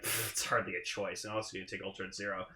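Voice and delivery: low, soft, offhand dismissal; imitating a smug shrug-off; cool and smug. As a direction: toss it off with mock smugness. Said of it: "all fucking cool and smug".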